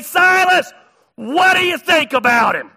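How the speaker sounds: loud, high shout to Silas; calling out. Acted. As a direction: holler the question across to him.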